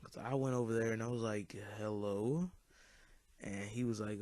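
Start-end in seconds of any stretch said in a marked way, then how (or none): 1.8-2.5 questioning hello with a rising end; retold confrontational hello, rising; pointed greeting. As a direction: lift the end into a challenge. Retold confronting the pair hugging.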